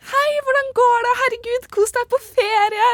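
high, sing-out phone cheer; faked cheerfulness. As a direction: overdo the brightness to hide tears. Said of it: "kan ikke begynne å gråte på telefonen".

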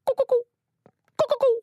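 high squeaky mock animal call; silly animal call. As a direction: squeak a made-up animal noise. Giraffe noise in drama. (høy pipete dyrelyd)

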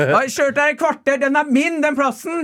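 very high, outraged insistence; mock furious argument; imitated shouting. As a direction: stake the claim in a high-pitched holler. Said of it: "roper hun ut sånn".

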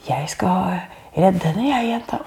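doctor's reassurance. Retold or imitated. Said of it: "Og så sa han sånn".

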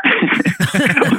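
a real embarrassed laugh; short giggle at the memory; sheepish laugh. Real, not acted. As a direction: laugh it off at yourself. Laughing at his own story.

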